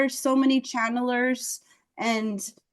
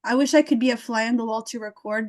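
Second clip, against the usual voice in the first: usual speaking voice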